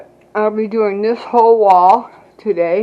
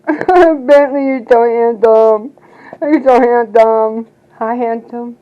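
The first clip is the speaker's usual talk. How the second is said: talking to a dog; 7 dB louder, slower than usual. Real, not acted. Gushing at her dog.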